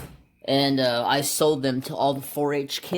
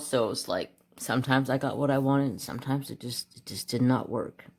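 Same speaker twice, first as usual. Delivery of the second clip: ordinary talk, nothing marked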